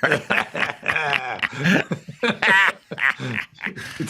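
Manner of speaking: a long rising burst of real laughter; amused laugh. Real, not acted. Laughs at the love doctor joke.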